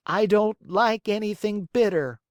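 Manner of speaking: put-on high, stubborn little boy voice; a spoiled child refusing; whiny puppet boy. A narrator's reading. Pinocchio refusing.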